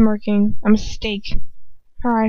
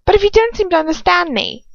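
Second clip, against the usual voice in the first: hardened voice; 7 semitones higher than usual. A narrator's reading. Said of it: "Her voice hardens".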